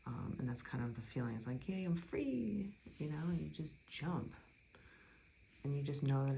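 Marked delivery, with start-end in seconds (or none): 1.7-4.3 high, drawn-out mock cheer of freedom; carefree cheer. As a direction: play a gleeful "wheee", stretched and high. Voices the card's carefree feeling.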